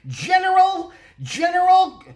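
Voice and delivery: high, booming character call; acted shout. Fan recalling the actor's character by name, high acted shout. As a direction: belt it out far above your talking voice.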